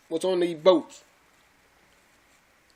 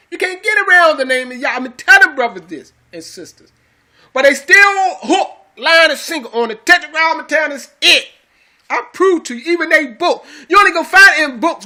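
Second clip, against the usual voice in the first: high, forceful pulpit delivery; insistent preaching; 7 semitones higher, 6 dB louder than usual. Real, not acted. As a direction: press the argument hard, pitch lifted.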